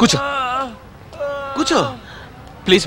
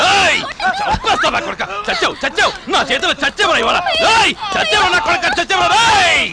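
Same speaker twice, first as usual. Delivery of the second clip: furious shout; 11 semitones higher than usual. Acted. Man yelling at the biker who ran over his foot, furious shouting. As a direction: scream threats at him.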